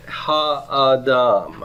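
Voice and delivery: very slow "ha'adam" with each vowel held; syllable by syllable. Shown as a demonstration. Teacher introducing the Hebrew word, "ha'adam" slowly in separate syllables. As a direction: draw out "ha'adam" as ha, ah, dahm. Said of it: "you have this word".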